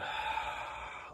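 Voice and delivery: long, voiceless sighing exhale; audible sigh. Shown as a demonstration. Meditation guide shows listeners the exhale, audible sigh. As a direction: sigh the breath all the way out. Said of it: "sigh it out".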